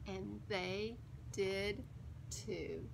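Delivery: usual speaking voice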